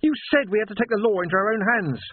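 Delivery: put-on angry young shout. A narrator's reading. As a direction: throw his words back at him. Sam's angry protest continues.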